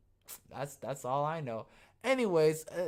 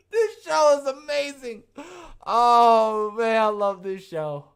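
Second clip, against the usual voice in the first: laughing; 7 semitones higher, 11 dB louder, slower than usual. Real, not acted. Still laughing after slip.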